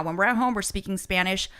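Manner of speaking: usual speaking voice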